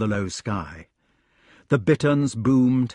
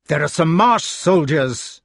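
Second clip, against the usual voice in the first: raised, louder warning; alarmed knight; 5 semitones higher, 6 dB louder than usual. A narrator's reading. Knight distracts Lancelot with a warning, alarmed. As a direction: sound the alarm about pursuers.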